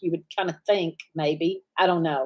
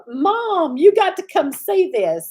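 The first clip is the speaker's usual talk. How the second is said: higher, excited call to mom; excited granddaughter; 8 semitones higher, 4 dB louder, slower than usual. Retold or imitated. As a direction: call mom over, thrilled to show her. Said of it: "And she's like".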